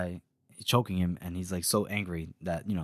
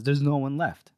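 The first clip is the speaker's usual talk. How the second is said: ordinary delivery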